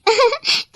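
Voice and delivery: high nervous giggle; nervous cover-up giggle. Acted. Possessed bride laughs off her slip to mother-in-law, high giggle.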